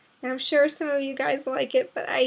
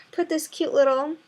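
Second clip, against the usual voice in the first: ordinary talk, nothing marked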